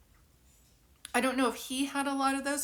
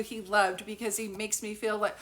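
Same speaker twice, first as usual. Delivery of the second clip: ordinary talk, nothing marked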